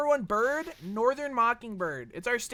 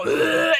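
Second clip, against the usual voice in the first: loud higher mock gag; a penguin startled into vomiting; imitated penguin retch; 6 semitones higher, 9 dB louder than usual. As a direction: fake a retching ugh. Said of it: "they'll go".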